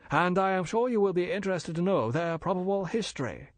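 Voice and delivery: polite and bland, a dealer explaining; bland Chinese merchant. Acted. Older Fu brother lecturing the major, smooth and polite.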